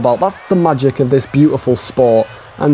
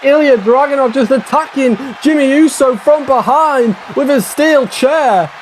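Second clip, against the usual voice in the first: very high, urgent hype call; excited play-by-play; 12 semitones higher than usual. Real, not acted. Commentator calling a steel chair attack, thrilled. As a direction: draw out the ending at high pitch.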